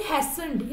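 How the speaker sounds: "hastened" with the T silent. Shown as a demonstration. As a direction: say "hastened" as HAY-sənd, dropping the T. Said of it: "टी silent होगा".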